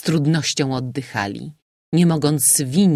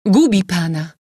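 short, raised warning cry; 4 semitones higher, 7 dB louder than usual. A narrator's reading. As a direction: cry out a warning, higher and louder. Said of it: "krzyknęła Helena do mordercy".

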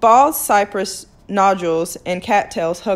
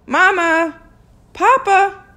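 high loud call through the house; 11 semitones higher, 5 dB louder, slower than usual. Acted. Girl calls for her parents at the door, loud. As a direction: call for your parents from the porch. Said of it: "flung open the door".

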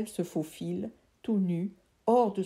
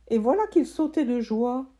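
ordinary delivery

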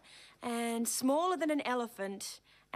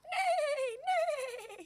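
high horse neigh; 13 semitones higher than usual. Acted. Horse imitation.

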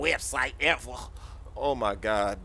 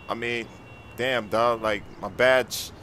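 normal talking voice

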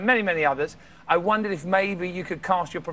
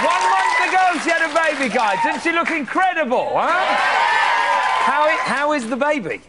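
excited; 8 semitones higher, 4 dB louder than usual. Real, not acted. Host hyping studio audience.

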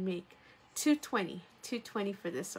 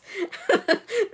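a loud, high burst of real laughter; 12 semitones higher, 10 dB louder than usual. Real, not acted. Seller laughs at her accent slip on live stream, real loud laugh. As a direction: crack up at your own slip.

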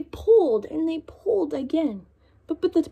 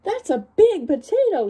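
mildly higher, louder exclaim; farmer amazed; 7 semitones higher, 5 dB louder than usual. Acted. Farmer admiring the giant potato, mild amazed exclaim. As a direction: marvel at the size. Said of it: "said the farmer".

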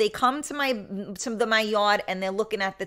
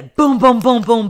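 loud banging booms, fast and hammered out; mimicked door pounding; 10 dB louder than usual. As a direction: pound out each boom like fists on a door.

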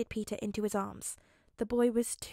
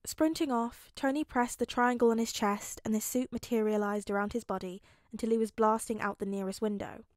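plain reading voice

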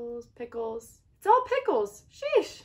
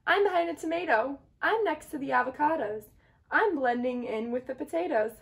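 higher, louder playful boasts; gleeful hiders; 4 semitones higher, 4 dB louder than usual. Acted. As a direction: brag about your hiding spot. Eggs hiding among vegetables.

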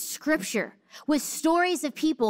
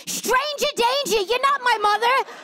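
high, loud hammed-up toddler wail; mock toddler scream; 10 semitones higher, 4 dB louder than usual. Mom mimicking toddler's public tantrum, a mock high scream. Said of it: "she screamed".

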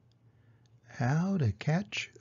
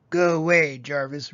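loud, irritated boar's yell; 3 dB louder than usual. A narrator's reading. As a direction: shout him away. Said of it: "Roy yelled".

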